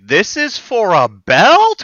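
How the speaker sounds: slow, incredulous rising question; acted disbelief. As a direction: stretch it out in mock astonishment. Acting out disbelief at the belt.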